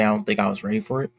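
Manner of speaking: ordinary talk, nothing marked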